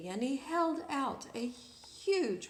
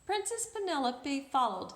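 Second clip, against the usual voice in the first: ordinary delivery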